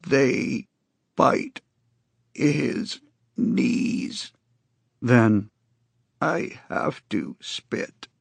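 slow, rasping croak, fading to a rough murmur; strange grating voice. Acted. Con tells Jacobs he has to spit, grating. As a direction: scrape out the rhyme, then the need to spit. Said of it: "Con said in his strange, grating voice".

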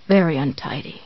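low dry croak. A narrator's reading. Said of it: "Paul croaked".

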